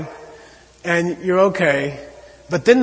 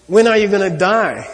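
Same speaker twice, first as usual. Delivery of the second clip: raised, pressing question; urgent quote; 4 semitones higher, 6 dB louder than usual. Retold or imitated. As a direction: ask it loud and urgent, like a warning. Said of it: "Master Shantideva gets into this thing like".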